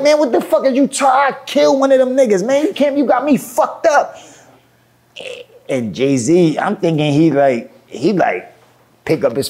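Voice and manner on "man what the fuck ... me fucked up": high-pitched indignant rant; getting mad. Retold or imitated. Rapper answering his producer's calm down, angry and high-pitched. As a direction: act out refusing to calm down, heated.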